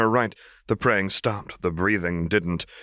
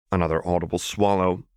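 ordinary delivery, nothing marked

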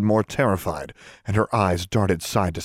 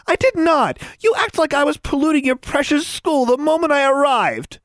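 very high, loud squawking protest; outraged retort; 5 dB louder than usual. A narrator's reading. Tomo denies starting it to Anna, outraged. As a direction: fire back high and indignant.

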